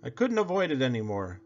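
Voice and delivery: ordinary delivery, nothing marked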